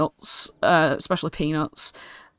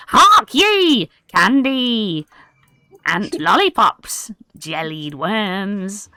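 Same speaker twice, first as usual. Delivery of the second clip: goblin hawking call; 3 semitones higher, 4 dB louder, much slower than usual. Acted. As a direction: shout the goods like a market goblin. Goblin boss hawks wares.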